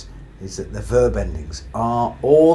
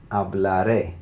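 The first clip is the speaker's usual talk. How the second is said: hablaré with emphasis on the last syllable, ré; hablaré, landing firmly on ré; final-syllable stress. Shown as a demonstration. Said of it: "particular emphasis on the last syllable".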